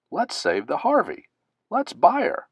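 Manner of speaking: high, eager cheering voice; bursting with a bright new idea; excited friends. Acted. Friends at a restaurant deciding to save the Harvey, excited.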